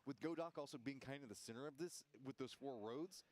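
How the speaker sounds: lively play-by-play commentary; esports caster. Acted. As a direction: call the match like a caster.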